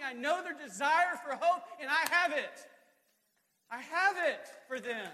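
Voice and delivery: very high, loud, building sermon shout; full-force preaching as God. Acted. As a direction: thunder out God's promise to the preacher.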